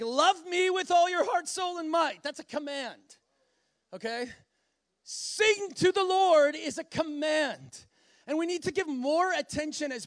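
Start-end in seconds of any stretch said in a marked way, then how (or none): 0.0-2.2 loud, high proclamation; emphatic command. Acted. Preacher quoting a do command of God, proclaimed loudly. 5.0-6.6 loud, high, drawn-out proclamation; resounding command. Acted. Preacher quoting God's command to sing, booming it out.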